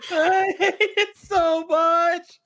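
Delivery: slow, high laughing complaint. Real, not acted. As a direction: laugh through the words. Laughing at the singing.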